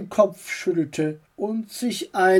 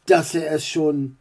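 ordinary delivery

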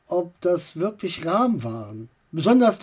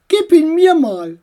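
higher, louder aunt voice; brisk aunt taking charge; aunt demanding the raven; 8 semitones higher, 7 dB louder than usual. Acted.

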